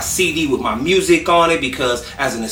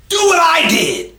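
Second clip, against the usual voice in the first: angry shout; 14 semitones higher than usual. Retold or imitated. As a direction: blow up at the pushy fan. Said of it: "he lost his shit".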